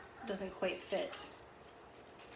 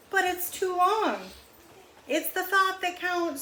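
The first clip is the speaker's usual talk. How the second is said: higher and louder, slow complaint; high squeaky animal voices; 10 semitones higher, 5 dB louder than usual. A narrator's reading. Reading aloud, high cartoon voices trading lines. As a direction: animals bickering about an oversized scarf. Goat then lemur, high and loud.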